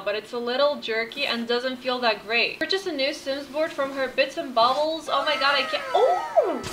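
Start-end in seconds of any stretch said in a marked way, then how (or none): 5.9-6.6 high, bright squeal of surprise; a sudden startled cry, much higher; surprised. Real, not acted. Gamer startled by her Sim's sudden move, high squeal.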